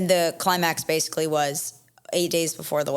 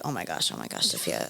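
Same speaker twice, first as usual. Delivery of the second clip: panicked undertone; 13 semitones lower, 6 dB quieter than usual. Retold or imitated. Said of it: "my husband is literally like".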